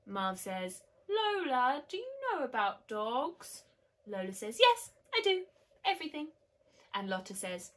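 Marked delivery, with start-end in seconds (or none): 1.1-3.6 put-on doubtful boy. A narrator's reading. Marv doubts Lola in story. 4.6-6.3 put-on high, emphatic child's boast; eager little girl. A narrator's reading. Lola assures Marv she knows dogs, eager and high.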